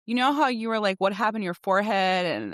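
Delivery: normal talking voice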